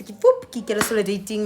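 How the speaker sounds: ordinary talk, nothing marked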